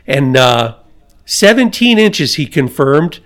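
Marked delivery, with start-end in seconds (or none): 1.2-2.3 lifted, emphatic retold bellow of seventeen inches; mock bellow. Old coach bellowing the answer at a crowd of coaches, mildly retold. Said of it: "his voice bellowing off the walls".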